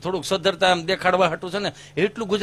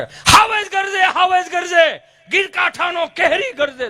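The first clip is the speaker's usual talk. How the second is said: much higher, louder, slow declaiming roar; booming schoolmaster recital; 10 semitones higher, 4 dB louder, slower than usual. Retold or imitated. Old schoolmaster roaring the poem at his class, loud and high. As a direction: belt it out like an old village teacher. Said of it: "ઈ જે આમ ઉપાડ કરે".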